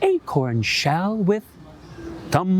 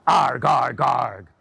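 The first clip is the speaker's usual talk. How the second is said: fox growl; 3 semitones lower, slower than usual. Shown as a demonstration. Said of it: "turn your hand into the fox and go".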